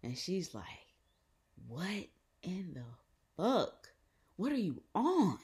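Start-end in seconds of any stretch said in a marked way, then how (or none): 1.7-5.4 high, halting mock outrage; imitated mom. Mom on FaceTime seeing the face, imitated outrage. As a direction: imitate an appalled mom, pitch climbing and getting louder. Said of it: "this is how my mom talks".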